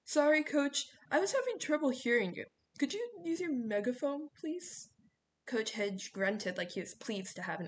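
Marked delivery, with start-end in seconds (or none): 0.0-4.9 high-pitched, sugary fake politeness; loud high start, softening to a pleading please; mischievous kid playing innocent. A narrator's reading. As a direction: call up to the coach, all innocence. Leo plays innocent to the coach.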